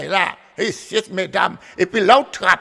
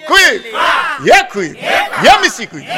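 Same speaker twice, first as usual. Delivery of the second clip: calling out; 3 semitones higher than usual. Acted. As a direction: rouse the audience with the call. Storyteller's call to the audience.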